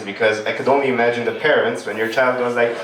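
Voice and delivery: usual speaking voice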